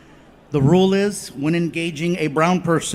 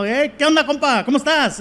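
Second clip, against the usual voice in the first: Mexican Spanish greeting; 5 semitones higher than usual. Acted. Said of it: "he reminded me of a tío".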